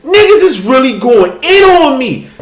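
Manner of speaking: alarmed excitement. Retold or imitated. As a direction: yelp as the hate piles on. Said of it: "I'm like".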